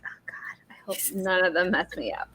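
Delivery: hushed, half-whispered inner thought; very soft retold aside to oneself; private worry. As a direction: mutter it under your breath, as retold worry. Retold inward worry about teachers' accents.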